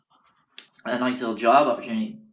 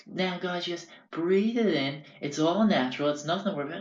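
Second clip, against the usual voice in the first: imitating Frank; 5 semitones higher than usual. Frank passing the vape round.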